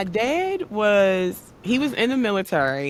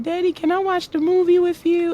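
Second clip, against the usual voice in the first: put-on high, louder little girl's plea; child asking; 12 semitones higher, 5 dB louder, faster than usual. Little girl begging her dad to watch, high and eager. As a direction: ask sweetly like a small child.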